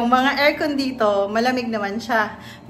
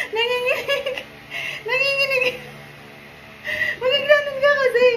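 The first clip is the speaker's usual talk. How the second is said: mock whimper; 13 semitones higher than usual. Puppy trembling on stairs.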